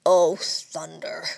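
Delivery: low, slow, grumbling growl; a low slow grumble under the breath. A narrator's reading. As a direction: mutter it like a thwarted father. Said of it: "growled Papa".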